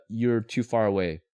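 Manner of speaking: usual speaking voice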